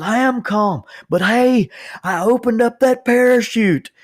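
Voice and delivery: high, loud yell into the phone; retold yelling. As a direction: shout it like he can't hear himself. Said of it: "he called me screaming into the phone".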